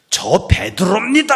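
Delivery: high, loud boastful shout; proud shout. Acted. Peter's boast voiced.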